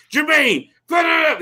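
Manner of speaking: high-pitched mock yell into a phone; imitated screaming. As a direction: imitate a boss ranting down the phone. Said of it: "He's, like, screaming at [name]".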